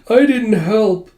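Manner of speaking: high, drawn-out mock whine; mock tearful. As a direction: imitate a weepy, self-blaming wail. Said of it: "dry those tears".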